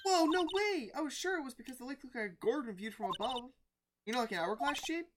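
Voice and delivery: high-pitched amazed sidekick; Maya, amazed. Acted. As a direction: gush with surprise in a high voice.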